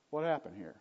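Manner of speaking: ordinary talk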